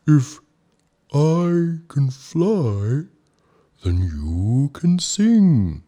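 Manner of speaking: very slow, high nervous whale voice; a nervous whale swallowing hard between words; gulping whale. A narrator's reading. Ooga bargains with Snow Bear, gulping nervously. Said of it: "the whale gulped".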